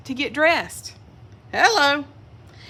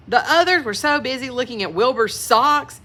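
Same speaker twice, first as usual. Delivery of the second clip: ordinary delivery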